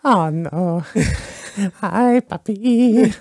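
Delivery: put-on soft, drawn-out Mexican accent; mock Mexican accent. Host reading out a listener's name, mock Mexican accent. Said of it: "I'm doing a Mexican voice for that".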